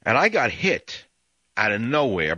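normal talking voice

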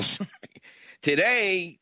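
ordinary talk, nothing marked

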